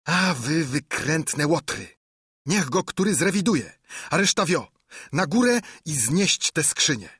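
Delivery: high-pitched impatient scolding; bossy villain driving his gang on. A narrator's reading. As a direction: berate the dawdling men. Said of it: "Ślepiec znów ich złajał za ociąganie się".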